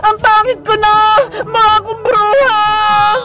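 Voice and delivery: mock-crying, drawn-out dismay; horrified wail. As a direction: wail at your reflection, stretching the endings. Character horrified by face in mirror. (pangangawang pagkagulat sa salamin)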